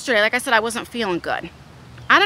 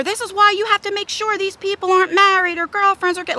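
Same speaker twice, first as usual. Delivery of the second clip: high, rattling retold lecture; going off; 8 semitones higher than usual. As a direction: re-enact a mother on a tirade. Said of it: "I'm just going off".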